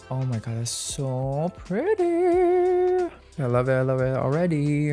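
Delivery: squealing high with delight; excited shriek of joy; gushing. Real, not acted. Gushing over the blush color.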